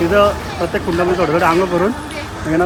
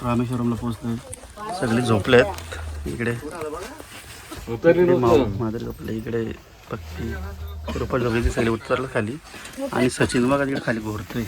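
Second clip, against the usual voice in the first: quiet at night; 5 semitones lower, 8 dB quieter than usual. Real, not acted. Early morning, others asleep on train.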